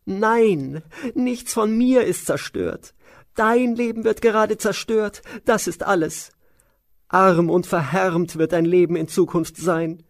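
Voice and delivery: high, loud, shrill servant voice, slowing at first; Mantara's shrill voice. Acted. Maid warning the queen of ruin, high and shrill. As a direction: spit out the bad news up high. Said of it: "sagte Mantara".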